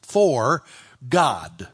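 word by word, "God" dropped in with weight; stress on GOD. Shown as a demonstration. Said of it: "the next word that you'd emphasize".

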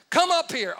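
booming, high-pitched call; loud voice from heaven. Acted. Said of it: "Loud again. The vo-, the voice said".

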